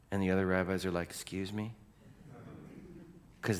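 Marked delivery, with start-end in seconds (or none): 1.1-1.7 soft, hushed question; stunned reaction. Acted. Preacher as rabbis who know Scripture, hushed disbelief. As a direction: ask it quietly in disbelief.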